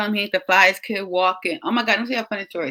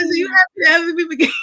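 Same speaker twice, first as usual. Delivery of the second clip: teasing; 9 semitones higher, 4 dB louder, faster than usual. Retold or imitated. Mother teasing son mid-laugh.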